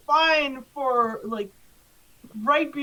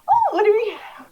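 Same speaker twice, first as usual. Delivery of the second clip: high, loud, flustered welcome; greeting at the door; 7 semitones higher, 6 dB louder than usual. Acted. Man at the door greets trick-or-treaters, high and hesitant. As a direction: pitch it up, a big hesitant hello. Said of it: "he greets you and he's like".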